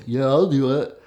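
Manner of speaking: lightly voiced eager teenage boy; a hint of cocky teen agreement. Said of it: "especially teenage boys".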